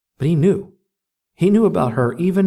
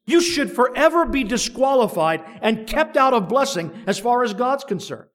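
higher, driving preacher's voice building to a climax; impassioned preaching; 10 semitones higher than usual. Acted. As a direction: preach it full force, lifting the room.

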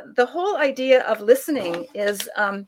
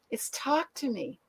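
"talk to me" said softer and slower, set down quietly; soft restatement; 2 semitones higher, 10 dB quieter than usual. Retold or imitated. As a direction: settle "talk to me" gently after the contrast. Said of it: "And he says".

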